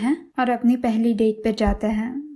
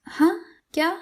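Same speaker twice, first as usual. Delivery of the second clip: higher, teasing "हाँ, क्या?"; playful feigned deafness; mock not hearing; 8 semitones higher than usual. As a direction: pretend you didn't catch it. Yai pretends not to hear.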